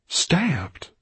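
high breathy whisper of disbelief. A narrator's reading. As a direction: breathe the word Stabbed in shock. Orpheus puzzling over the book.

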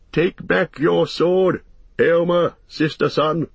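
high, ringing royal command; commanding king. A narrator's reading. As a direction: order it like a revived king addressing his men. Revived king gives commands.

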